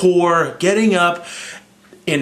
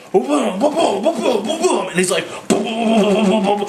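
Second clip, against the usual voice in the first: mock smash sounds; 7 semitones higher than usual. Hulk smashing Loki, retold.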